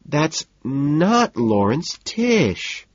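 high, slow, drawn-out whine; petulant high-pitched protest; whining. A narrator's reading. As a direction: whine it out, upset. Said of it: "Evelyn whines".

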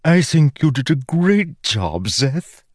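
high, bright magic sword voice; chirpy enchanted sword, eager to praise; ringing metallic sword voice. Acted. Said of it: "the sword said".

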